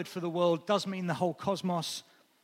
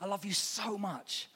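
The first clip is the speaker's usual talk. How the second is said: softer and slower I love you so much; slow, soft, loving line; tender so much; 3 semitones higher, 7 dB quieter, slower than usual. Shown as a demonstration. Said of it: "adds a bit of the mm".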